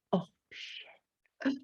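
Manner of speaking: hushed mock dread; alarmed whisper. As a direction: whisper it as the boss walks by. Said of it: "and you're like".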